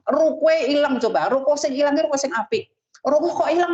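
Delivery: high, wondering cry at a loss; mock surprise. Preacher acting out losing a shop, high and surprised. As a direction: act startled at the missing shop.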